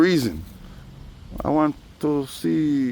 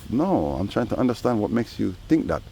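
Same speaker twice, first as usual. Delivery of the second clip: usual speaking voice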